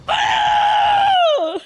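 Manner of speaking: very high, loud cry of real thrill; excited shout. Real, not acted. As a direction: shriek at the play in real joy. Reacting to a big play.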